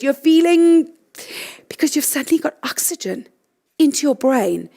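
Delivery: usual speaking voice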